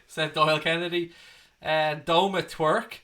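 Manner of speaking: ordinary delivery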